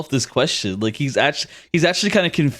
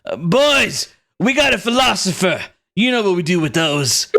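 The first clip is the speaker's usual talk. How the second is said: mock call to boys; 4 semitones higher than usual. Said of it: "he just yells out, he says".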